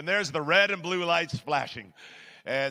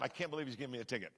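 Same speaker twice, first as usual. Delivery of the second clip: muttered disbelief; 6 semitones lower, 13 dB quieter, much faster than usual. Retold or imitated. Inner thought, disbelief.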